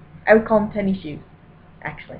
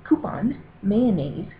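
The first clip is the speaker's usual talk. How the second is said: ordinary talk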